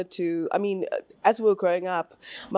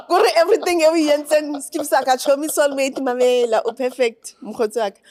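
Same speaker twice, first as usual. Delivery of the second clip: laughing; 7 semitones higher, 6 dB louder than usual. Real, not acted. Laughing joking to mother.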